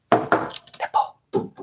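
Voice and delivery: whispered password. Pretend. Giving Steven the secret password, whispered. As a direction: breathe it like a secret code. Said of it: "whispering the password".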